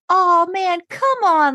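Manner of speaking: high, loud puppyish whine; cute whiny Sam. Retold or imitated. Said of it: "so cute when he's like".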